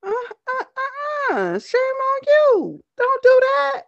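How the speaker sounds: high, loud playful scolding; mock scolding. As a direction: scold them in a high squeal. Mock scolding a rival fan.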